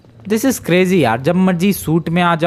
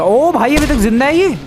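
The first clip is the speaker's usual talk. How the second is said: astonished; 10 semitones higher than usual. Real, not acted. The crushed man is still alive.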